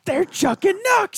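very high, strained catchphrase cry; mock yell. Co-host playing along with the wrestling catchphrase, high mock yell. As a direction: shout it at the top of your range, without much volume. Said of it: "you're gonna yell, "Chucking Nucks".

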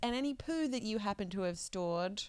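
loud rapid proclamation; rally the crowd, loud and fast; town crier. Pretend.

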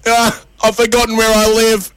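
high, wailed punchline; hammed-up tearful confession; mock crying. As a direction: bawl it out like a weepy old man. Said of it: "What are you crying for".

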